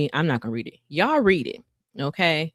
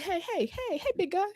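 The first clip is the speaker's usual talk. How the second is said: high, soft coaxing voice; calm a huge angry boss gently; soothing Moses; 4 dB quieter than usual. Retold or imitated. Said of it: "He said".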